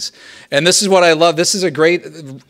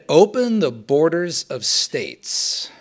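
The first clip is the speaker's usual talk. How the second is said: ordinary talk, nothing marked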